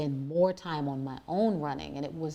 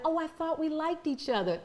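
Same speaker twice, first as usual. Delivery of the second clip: high-pitched put-on teacher voice; sweetly wounded mimicry, pitched way up; mock hurt teacher; 10 semitones higher than usual. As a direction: imitate a teacher acting let down. Voicing teachers' hurt reaction.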